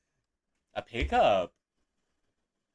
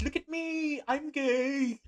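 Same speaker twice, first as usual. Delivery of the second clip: high squeaky meme quote; high mock cat voice; slower than usual. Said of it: "the cat meme on YouTube here that goes".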